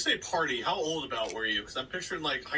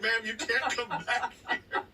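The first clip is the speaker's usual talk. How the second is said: lightly voiced high, slow objection; a laughing imitation of staff; hospital staff; 9 semitones higher than usual. Staff stopping mom following patient, mildly firm through laughter.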